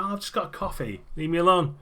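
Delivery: woman being dragged out, protesting; whiny protest. Acted. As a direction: whine at the cop to back off.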